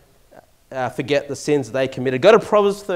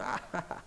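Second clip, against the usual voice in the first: very soft, breath-only mock chuckle; imitated parent's laugh; 16 dB quieter than usual. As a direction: laugh indulgently, as an amused parent would. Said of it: "seen the parents just, like, laugh".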